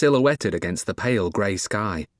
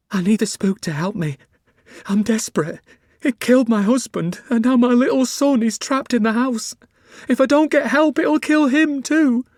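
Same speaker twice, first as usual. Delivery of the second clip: high, loud, tearful pleading; desperate sobbing woman; 14 semitones higher, 5 dB louder than usual. Acted. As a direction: beg through tears, voice cracking high. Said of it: "hardly able to control her sobbing".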